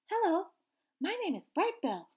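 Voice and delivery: a small chick proudly introducing himself; little gosling voice. Acted. Gosling introduces himself to anyone listening, high and eager.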